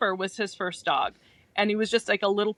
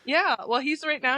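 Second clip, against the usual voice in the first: ordinary delivery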